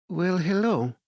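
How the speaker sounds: a gracious French director's greeting; French accent. A narrator's reading. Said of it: "her English marked with a French accent".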